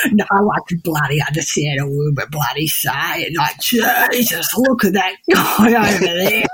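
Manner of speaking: low, exaggerated Aussie bogan mumble; real bogan Australian. Shown as a demonstration. Secretly remarking on someone at a bar, bogan Australian. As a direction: slur it in thick bogan Australian. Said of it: "real bogan".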